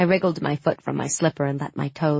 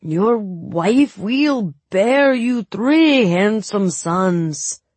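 high, loud, slow fake Suli accent; fortune-teller ham; hammed Suli accent; 5 semitones higher, 5 dB louder, much slower than usual. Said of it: "Suli accent".

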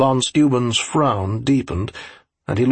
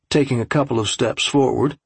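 ordinary delivery

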